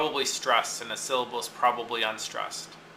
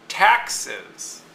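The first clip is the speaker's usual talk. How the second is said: taxes with TAX stressed high, ES light; stress on first syllable; 5 semitones higher than usual. Shown as a demonstration. Teacher giving an example word, taxes with TAX stressed. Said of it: "Tax is the base word".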